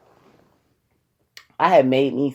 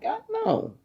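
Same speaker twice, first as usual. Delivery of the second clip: squeaky dismay; 8 dB quieter than usual. Retold or imitated. Her reaction to the news.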